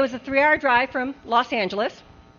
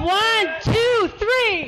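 counting off; 11 semitones higher, much slower than usual. Real, not acted. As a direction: call out the count to the crowd. Counting off loudly to the room.

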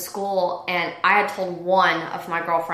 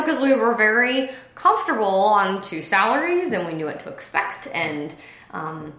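usual speaking voice

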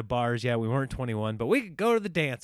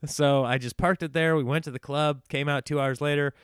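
normal talking voice

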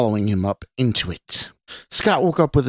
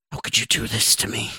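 raspy, whispered reproach; whispered cry. A narrator's reading. Wronged woman on the bed accuses Scott, whispered cry. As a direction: cry it out under the breath, in a whisper. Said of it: "the woman cried".

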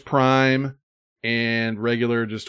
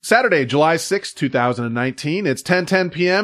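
brisk announcer intro, raised pitch; show announcer; 6 semitones higher, 4 dB louder than usual. Acted. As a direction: announce the show's date and number, falling at the end.

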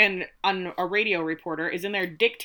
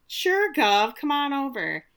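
sigh, then a higher put-on agreement; mock reluctant compliance; 6 semitones higher, slower than usual. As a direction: lightly play a put-upon underling humouring the boss. Guard grudgingly answering the governor.

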